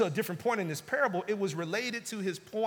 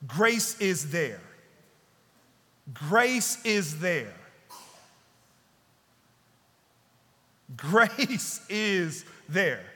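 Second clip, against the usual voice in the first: slow emphatic repetition; much slower than usual. Shown as a demonstration. Preacher repeating key line.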